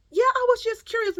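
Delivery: very high, loud excuse; imitated nosy woman. Nosy shopper explaining herself, mockingly imitated. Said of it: "She say".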